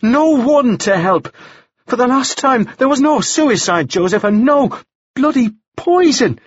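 high, vehement outburst; crying out. A narrator's reading. Woman denying suicide and poison to her accuser, crying out. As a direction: sustain a high-pitched, exasperated protest to the end. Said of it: "Anna cried".

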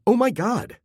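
high-pitched startled exclamation; calling out. A narrator's reading. Guests bowled over by a bolting dog, calling out. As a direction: cry it out high in alarm. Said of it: "human voices call out".

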